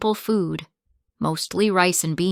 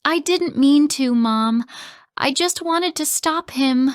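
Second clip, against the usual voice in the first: higher, louder pleading sob; sobbed; 9 semitones higher, 6 dB louder than usual. Acted. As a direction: plead through tears. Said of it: "I sobbed".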